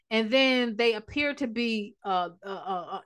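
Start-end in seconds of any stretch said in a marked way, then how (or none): none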